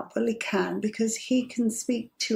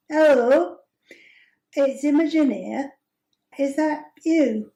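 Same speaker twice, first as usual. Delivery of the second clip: higher, louder mock-posh phone voice for a laugh; a playful put-on accent, pitched up; 4 semitones higher, 3 dB louder than usual. Imogen greets her date on the phone in a put-on accent. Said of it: "This is how I normally speak".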